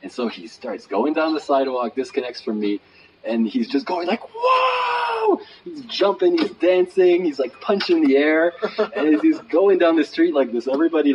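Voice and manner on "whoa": very high, shouted "whoa"; joyful whoop. Retold or imitated. Imitating the man jumping and dancing away, joyful whoop. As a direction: let out a drawn-out whoop of joy.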